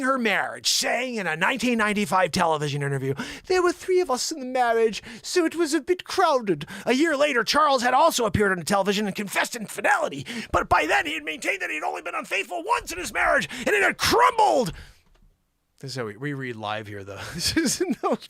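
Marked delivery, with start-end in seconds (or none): 3.5-6.6 high English voice for a quote; put-on English accent. Host quoting Diana's television interview, put-on English accent. 6.9-14.7 high shouted read, building to a peak; mock-outraged reading. Host reading Charles's confession aloud, mock outrage.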